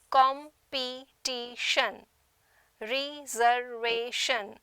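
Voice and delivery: competition, reservation slowly, in four separate beats each; com-pe-ti-tion, re-ser-va-tion, pausing between syllables; split into syllables. Shown as a demonstration. Teacher showing tetrasyllabic words, split syllable by syllable. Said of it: "are tetrasyllabic".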